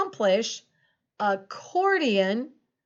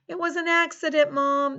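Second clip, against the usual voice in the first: put-on higher-pitched child excusing a mishap to a parent; child pleading innocence; 4 semitones higher than usual. Child telling Mom about spilt milk, high and pleading.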